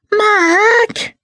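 very high, loud shriek of his name; frantic shout. Acted. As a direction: scream his name through the door. Fangirl pounding on the door.

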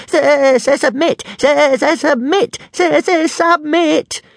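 very high, loud stuttering shriek. A narrator's reading. Little dragon stutters to Hiccup to give up, shrieking. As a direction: shriek it at the top of your voice, stuttering. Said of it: "shrieking ... at the top of his voice".

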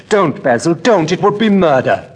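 put-on young man begging the painter to spare the portrait, voice climbing high; desperate plea. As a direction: beg him to stop, rising in alarm. Dorian begging Basil not to destroy painting.

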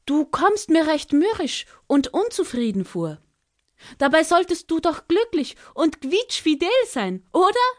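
high, coaxing little bird voice; Ara spricht weiter. Acted. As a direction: keep flattering in a high bird voice. (hohe, lockende Vogelstimme)

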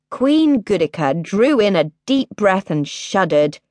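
usual speaking voice

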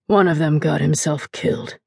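low, soft slurred mumble; drunk mumble. Acted. Drunk father confessing to his wife, low slurred mumble. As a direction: drop it low and heavy.

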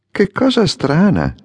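high, sharp exclamation of surprise; a startled cry of wonder; surprised exclamation. A narrator's reading. Swallow feels a drop from a cloudless sky, surprised. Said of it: "esclamò".

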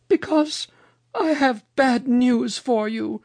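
very high, slow bearer of bad news; Mother Goose. A narrator's reading. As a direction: break the news slowly in a high lady voice.

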